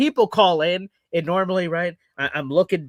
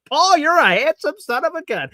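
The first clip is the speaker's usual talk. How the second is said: a higher, louder mock-admiring voice; viewers' flattery; 10 semitones higher, 4 dB louder than usual. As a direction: gush at Paul like a fan. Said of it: "all of you are saying".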